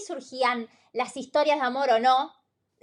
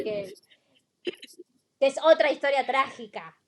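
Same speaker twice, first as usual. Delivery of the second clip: usual speaking voice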